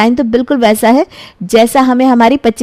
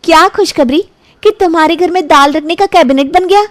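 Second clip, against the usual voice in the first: put-on higher, sulky young woman; lightly voiced girlfriend still cross; annoyed girlfriend; 8 semitones higher than usual. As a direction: girlfriend brushing off her boyfriend, mildly annoyed.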